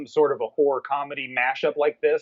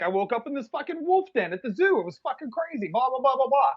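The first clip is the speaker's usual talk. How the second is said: high, hurried babble dissolving into blah blah; high-pitched, dismissive mock rambling; mock frantic retelling; 10 semitones higher than usual. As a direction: mimic someone rambling through a crazy story, high-pitched. Acting out David's rambling story.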